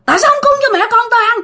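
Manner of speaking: high, fast furious scolding; high, harsh threatening tirade from the ghost; ghost cursing. Retold or imitated. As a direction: curse them out like a vengeful ghost. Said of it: "Cổ chửi".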